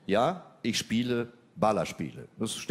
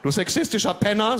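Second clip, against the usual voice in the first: high, louder, laughing insult; mock angry insult; 12 semitones higher, 6 dB louder than usual. Girlfriend scolding him, high, loud mock outrage. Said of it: "sagte meine Freundin".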